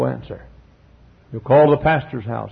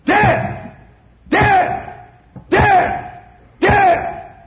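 shouted cries; much slower than usual. Acted. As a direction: hurl each Death! at the crowd. Repeated cries in sermon.

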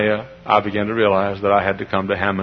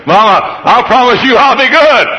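high, loud eaglet plea; begging child; 13 semitones higher, 12 dB louder than usual. Acted. Eaglet promises Mama good behaviour, begging loudly.

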